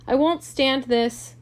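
loud, high, drawn-out protest; distressed, slow exclamation; crying out. A narrator's reading. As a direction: cry out at breaking point. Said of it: "cried Daisy".

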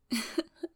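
short breathy giggle; amused chuckle. Acted. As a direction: laugh lightly at the listener. Amused by the listener.

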